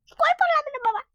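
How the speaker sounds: high, loud startled wail; alarmed cry. Real, not acted. Has just fallen in game. (আতঙ্কে চড়া গলায় চিৎকার)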